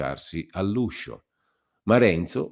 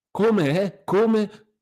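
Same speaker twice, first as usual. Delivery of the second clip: much higher, indignant repeated question; almost scornful; 6 dB louder, much slower than usual. A narrator's reading. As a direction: throw it back with disdain. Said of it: "rispose con voce quasi sdegnosa". (quasi sdegnoso, molto acuto)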